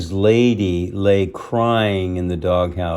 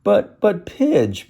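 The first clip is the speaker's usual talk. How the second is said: hesitant plea; 12 semitones higher, 4 dB louder, slower than usual. Acted. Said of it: "began Tramp".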